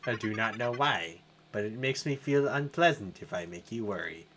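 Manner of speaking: low, soft musing AI; low hushed computer voice; calm computer voice. Acted. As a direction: speak like a puzzled machine. Tech voice.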